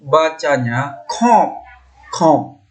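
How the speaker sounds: kop with air through the nose, nasalised o, slow; teacher demonstrating nasal kop. Shown as a demonstration. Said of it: "oh ini".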